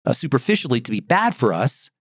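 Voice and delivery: usual speaking voice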